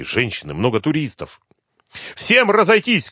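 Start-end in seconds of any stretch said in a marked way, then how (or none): 2.1-3.1 very high, urgent shout; high-pitched emergency order. A narrator's reading. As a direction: shout a crowd away. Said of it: "кричала Суслова".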